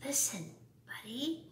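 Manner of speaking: soft, hushed "Listen, Buddy"; whispering. A narrator's reading. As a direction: parents murmur it gently. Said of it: "They tried whispering".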